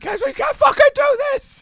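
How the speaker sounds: very high, loud mock yell; imitated scream. Imitating a comic screaming at the crowd, high mock yell. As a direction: scream it in hammed-up exasperation. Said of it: "He'd scream at the crowd".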